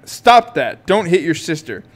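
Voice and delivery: loud parental command; shout. A narrator's reading. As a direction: shout them apart. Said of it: "shout at exactly the same time".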